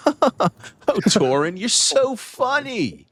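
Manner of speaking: high, slow, sugary flattery; fawning gentleman. Acted. Gentleman flattering Torin with a laugh. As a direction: gush over Torin. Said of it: "and he goes".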